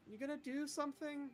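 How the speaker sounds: high-pitched character voice; in-character questioning. Acted. Rakona talks to the orb at the shrine, high character voice.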